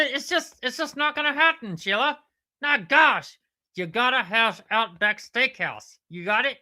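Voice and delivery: high-pitched puppet platypus, indignant; exasperated platypus character. Pretend. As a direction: rant in a high cartoon platypus voice. Platypus rants about the restaurant.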